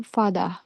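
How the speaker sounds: "fada" with a D sound, no final R; South African father. Shown as a demonstration. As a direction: say "fada" with D and open ah. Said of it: "pronounced fada".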